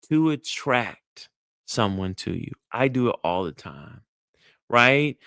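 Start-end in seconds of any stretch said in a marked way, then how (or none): none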